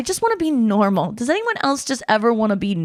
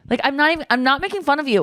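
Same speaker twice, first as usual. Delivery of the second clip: ordinary talk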